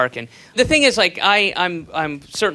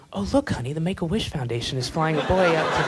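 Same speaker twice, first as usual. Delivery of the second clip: whispering passenger; 3 dB quieter than usual. Retold or imitated. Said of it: "somebody's like".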